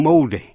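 "moldy" with a long "oh"; stressed "oh" vowel in "moldy". Shown as a demonstration. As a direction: say "moldy" as MOHL-dee. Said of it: "có thể đọc là O".

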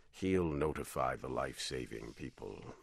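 low, soft captain voice; low calm captain. A narrator's reading. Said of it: "He always spoke in a low voice and calmly".

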